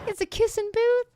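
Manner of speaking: squeaky high pitched repeat. Pretend. Streamer repeats line in odd voice.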